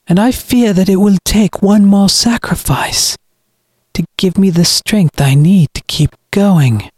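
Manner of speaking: high-pitched, self-justifying villain; cold brother. Acted. Brother condemns his sister in the well, cold. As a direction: explain the coming sacrifice coldly.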